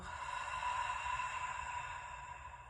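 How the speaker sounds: long slow audible exhale through the mouth; a slow, deep demonstration breath; deep breathing demo. Shown as a demonstration. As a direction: breathe all the air out slowly. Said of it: "show you one".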